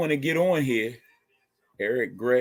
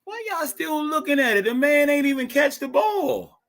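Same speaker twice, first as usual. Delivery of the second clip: incredulous; 11 semitones higher than usual. Real, not acted. Disbelief at replay, laughing.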